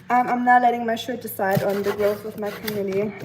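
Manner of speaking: usual speaking voice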